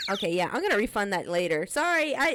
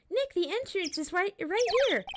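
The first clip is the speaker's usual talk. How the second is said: put-on higher, bubbly teenage girl voice, teasing; Maya character voice; 10 semitones higher than usual. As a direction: play the cheeky girl sidekick, pitched up. Voicing Maya again.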